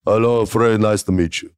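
imitating the giant's foreign-accented English; thick put-on accent of a giant wrestler; imitated giant. Giant wrestler greeting the new writer, heavily accented. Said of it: "this is what I heard".

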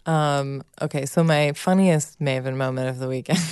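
ordinary talk

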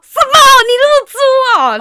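very high, loud shocked yell; shocked shout. Retold or imitated. To the date in bed, shocked yell. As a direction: yell out in disbelief. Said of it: "吼很大声说".

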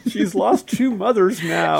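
slow laughing mock sob; mocking fake crying. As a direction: parody the teary dad. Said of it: "fake crying voice".

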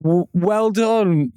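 slow, high, hesitant praise; faked encouragement. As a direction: humour a toddler with drawn-out approval. Parent praising toddler's scribble.

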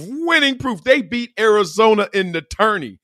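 normal talking voice

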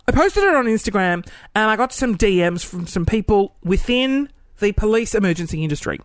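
normal talking voice

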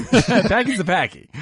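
mock-Bostonian, giggling through it; Packy's said with a laughing Boston twang; Boston accent. Shown as a demonstration. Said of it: "Boston accent demo".

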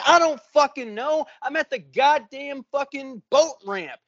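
high, fed-up comeback to a clueless question; retold exasperation. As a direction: act out losing patience on a call. Irritated answer to emergency dispatcher.

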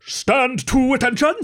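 slow, high roar into his ear. Acted. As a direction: bellow it as a wake-up order. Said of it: "roared as loud as I could".

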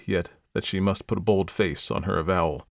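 ordinary delivery

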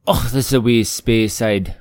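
loud, drawn-out Scottish burr with SPAY-side; Scottish lilt, throaty "och"; Scottish accent. Acted. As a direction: put on a hearty, slow Scottish accent. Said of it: "That sounds a bit more Scottish".

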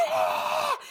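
a high shrieked ah; high scream. Retold or imitated. Recalling her reaction on the boat, high scream. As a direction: let out a short panicked shriek. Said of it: "was like".

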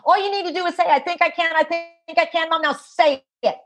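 put-on high, fast, insistent little boy voice; small child urging his injured mom; five-year-old boy. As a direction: play the exasperated kid pleading with mom. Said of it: "this five-year-old puts both hands on his hips".

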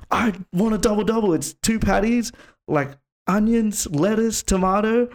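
high-pitched mock tirade; very angry customer. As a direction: imitate an irate customer, pitch way up. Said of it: "he got so angry".

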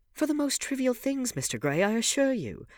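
high, loud and emphatic; animated complaint. A narrator's reading. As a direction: play up the exasperation. Duchess's exaggerated complaint in dialogue.

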